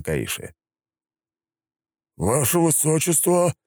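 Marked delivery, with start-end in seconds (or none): 2.2-3.5 strained, careful address; trying to sound calm; nervous major. A narrator's reading. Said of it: "начал он изо всех сил".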